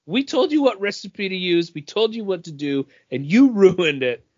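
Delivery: hammed-up scold from a TikTok chef; scolding TikTok cook. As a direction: play the exasperated TikTok cook telling people off, half laughing. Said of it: "TikToks where the people are like".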